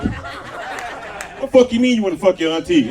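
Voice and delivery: hammed-up offended outburst; offended uncle. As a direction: act mock-outraged, raising pitch and volume. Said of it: "Then he got offended".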